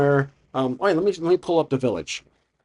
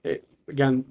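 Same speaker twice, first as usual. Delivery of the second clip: ordinary talk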